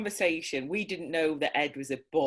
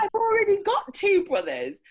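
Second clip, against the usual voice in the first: much higher, louder, laughing insistence; insistent child; 6 dB louder than usual. Retold or imitated. Boy correcting the grown-up, loud and insistent. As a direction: push back like a stubborn six-year-old. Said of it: "And he went".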